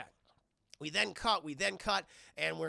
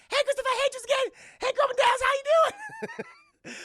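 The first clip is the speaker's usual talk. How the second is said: much higher, louder hammed-up character voice; over-the-top high mock voice for the movie quote; squeaky cartoon voice; 12 dB louder, much faster than usual. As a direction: crank it up into a silly squeal. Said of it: "what's the one line that Jackie Chan says".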